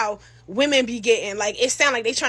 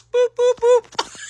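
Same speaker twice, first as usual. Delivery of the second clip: slow, high boop boop boop of a dropped call; hang-up beeps; 11 semitones higher, 6 dB louder, much slower than usual. Retold or imitated. Narrator mimics him hanging up, slow beeps. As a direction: mimic the call cutting off, drawn out.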